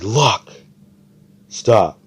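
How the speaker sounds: loud sharp yell at a teammate; scolding shout. Retold or imitated. Oz yelling at Luck to stop setting traps, loud scolding. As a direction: snap him out of it, loud and sharp.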